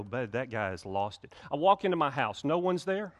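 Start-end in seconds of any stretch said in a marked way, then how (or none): 0.0-1.3 skeptical aside. Retold or imitated. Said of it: "y'all are gonna go".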